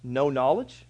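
ordinary talk

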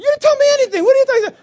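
high, loud, indignant shouting; indignant outburst. Retold or imitated. Duped man to his friend, indignant yelling. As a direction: yell it in outraged disbelief.

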